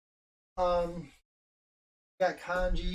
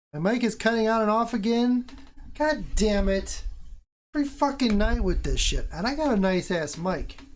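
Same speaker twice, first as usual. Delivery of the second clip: higher, louder angry complaint; real frustrated rant; 8 semitones higher, 5 dB louder than usual. Real, not acted. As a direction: vent at the broken mic. Mic cutting out, frustrated.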